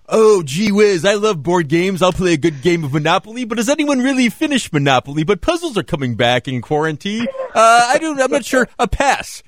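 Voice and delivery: high, excited goofy voice; goofy high impression. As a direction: play it up like an eager gameshow guest. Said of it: "And you go".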